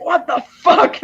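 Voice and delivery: high, slow, drawn-out disbelief; stunned what the fuck. Retold or imitated. Rick seeing the hippie girl burst in, stunned. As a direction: let it hang in shocked disbelief. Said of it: "It's like".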